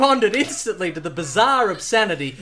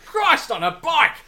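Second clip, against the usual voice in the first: high, loud Aussie outburst; Australian exclamation; 7 semitones higher, 5 dB louder than usual. Acted. Pilot exclaiming at Bond in Australian accent. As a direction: spit it out like an Aussie bloke. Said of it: "sprayed the pilot".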